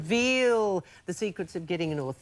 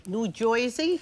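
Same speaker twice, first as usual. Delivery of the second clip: mock New Joisey accent, a little higher; New Jersey accent; 4 semitones higher, slower than usual. Host listing American accents to the coach, imitated New Jersey accent. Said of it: "so many different accents".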